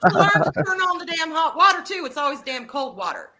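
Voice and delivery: loud, high-pitched laughing protest; laughing complaint. Real, not acted. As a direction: gripe in mock outrage through laughter. Joking complaint amid shared laughter.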